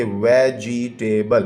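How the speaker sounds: Vegetable in four separate beats, वे-जि-टे-बल; teacher sounding out वे, जि, टे, बल; split into syllables. Shown as a demonstration. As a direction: break vegetable slowly with a gap after each syllable. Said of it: "syllables की तादाद बतानी है".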